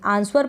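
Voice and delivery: आंसर as "ans-war"; wrong pronunciation. Shown as a demonstration. Teacher explaining a wrong reading, आंसर with a sounded W. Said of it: "पढ़ते हो तो गलत pronunciation हो जाएगा".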